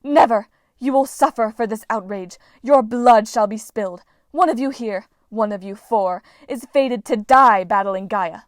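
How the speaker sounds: loud, menacing prophecy; enraged goddess foretelling death; furious curse. A narrator's reading. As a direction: pronounce doom at full volume. Goddess cursing the heroes.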